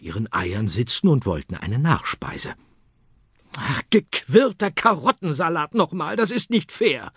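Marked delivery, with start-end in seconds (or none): 3.6-7.1 much higher, mock-cross bunny grumble; a cartoon rabbit complaining it's unfair; grumbling rabbit. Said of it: "schimpfte der Osterhase".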